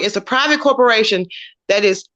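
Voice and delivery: ordinary talk, nothing marked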